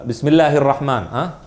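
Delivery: normal talking voice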